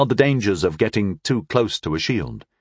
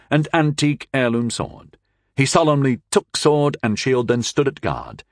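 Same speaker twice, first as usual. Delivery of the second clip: usual speaking voice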